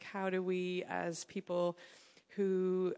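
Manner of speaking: ordinary talk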